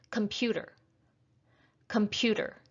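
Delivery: computer with the stress on PU, com-PU-ter; stress on second syllable. Shown as a demonstration. Teacher testing learners' ears, computer stressed on PU. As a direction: say computer clearly, leaning on the middle syllable PU. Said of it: "it's the second syllable".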